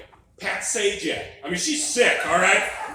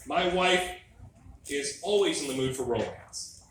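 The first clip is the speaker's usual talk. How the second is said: ordinary talk, nothing marked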